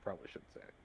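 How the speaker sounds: barely audible mutter under his breath. Acted. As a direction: mutter it to yourself, unvoiced. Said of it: "You hear him kind of mutter".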